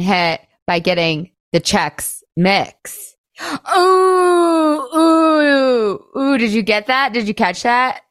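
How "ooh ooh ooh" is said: high, thrilled gasping ooh, ooh, ooh; excited ooh. Real, not acted. Host thrilled by her own wordplay, high excited oohs. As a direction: squeal with delight at her own pun. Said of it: "I'm so weird".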